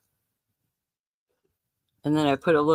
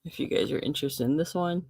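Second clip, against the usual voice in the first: normal talking voice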